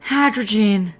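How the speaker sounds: high squeaky little creature voice; squeaky critter voice. Acted. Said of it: "he squeaky warned".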